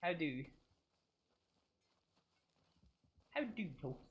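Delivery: put-on RP accent. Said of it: "what I do to get into my R P voice".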